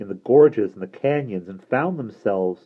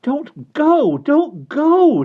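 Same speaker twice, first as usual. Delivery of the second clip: very high, loud desperate plea; frightened girl crying don't go; 9 dB louder, much slower than usual. Acted. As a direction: cry out to stop him leaving. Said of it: "Derna exclaimed".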